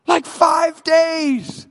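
high anguished scream at God; acted hysterical shouting, drawn out at the end; freaking out. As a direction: scream it, stretching the last words. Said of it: "He's just freaking out".

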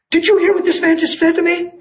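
high, incredulous imitation of a stunned woman; astonished mock quote. Lady at the railing to her daughter, astonished. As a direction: ask it high in disbelief. Retelling another lady's astonished question.

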